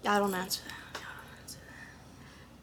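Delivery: hushed, voiceless whisper; whispered aside. Pretend. Answering her own "who hurt me", soft whisper. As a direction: whisper it as if to yourself.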